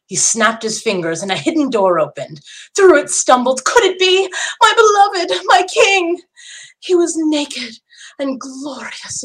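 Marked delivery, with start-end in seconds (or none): 2.7-9.2 much higher, near crying out; overwhelmed joy at seeing him; excited disbelief. A narrator's reading. Queen sees her captive husband.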